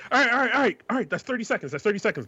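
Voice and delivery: high, flustered calling time; a boy backing out of a slap fight; mock hurried. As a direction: rush to call it off.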